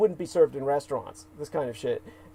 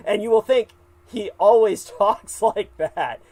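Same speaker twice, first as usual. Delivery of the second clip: slightly higher, laughing through the words; real laughing; 5 semitones higher, 4 dB louder than usual. Real, not acted. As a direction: let a chuckle break into the sentence. Laughs after describing shouted speeches.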